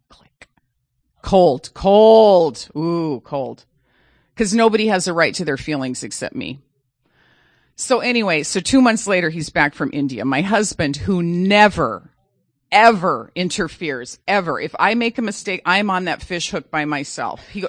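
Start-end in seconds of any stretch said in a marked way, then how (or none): 1.2-2.7 cold, cold said loud and heavy; stressed cold. Real, not acted. Stressing how cold she was. 11.0-14.6 never and ever drawn out slow and loud; stress on never ever. Real, not acted. Stressing husband never interferes.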